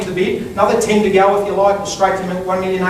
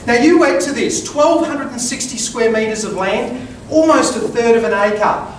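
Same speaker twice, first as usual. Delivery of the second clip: formal speech. Acted. Auctioneer's opening pitch.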